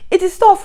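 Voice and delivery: high, loud insistence on TOUGH; emphatic repeat. Real, not acted. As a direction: exclaim it, pitch leaping up on TOUGH. Exasperated repeat about food hardship.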